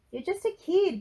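high, loud retort; shot back. A narrator's reading. Granddad puts Frankie down, shooting back. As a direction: fire it straight back, angry. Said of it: "Grandad shot back".

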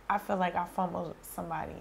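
usual speaking voice

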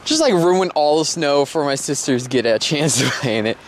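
usual speaking voice

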